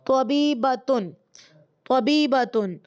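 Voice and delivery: طبیبتن said slowly, syllable by syllable, ending in -tun; teacher models طبیبتن for students, slowly and clearly; slow model word. Shown as a demonstration. Teacher models طبیبتن for students, slow and clear. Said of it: "اس پر تنوین ہی آتی ہے".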